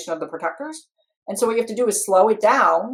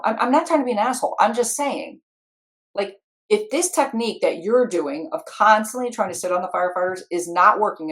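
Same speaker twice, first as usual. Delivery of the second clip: ordinary talk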